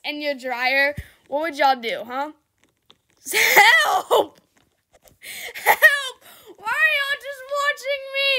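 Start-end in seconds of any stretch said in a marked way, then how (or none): 3.3-8.3 mock cries for help. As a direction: yell for help half laughing. Trapped in dryer, calling out.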